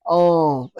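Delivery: ordinary talk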